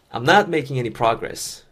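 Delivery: very slow, "not" punched and the rest light; stress on not. Shown as a demonstration. Teacher modeling the sentence for learners, stressing "not". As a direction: lean on "not", keep everything else even. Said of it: "강세를 낫에만 넣으시면 되고".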